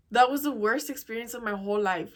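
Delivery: ordinary talk, nothing marked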